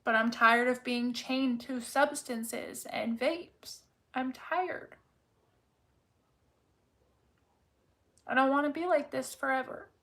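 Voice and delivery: tired, emotional voice on the edge of crying; close to tears. Real, not acted. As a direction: let the tiredness nearly break into tears. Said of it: "I feel like I'm gonna cry".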